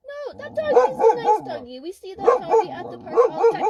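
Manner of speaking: soothing pet voice; high sweet dog talk. Real, not acted. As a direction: coo at the dog to calm her. Talking to barking dog.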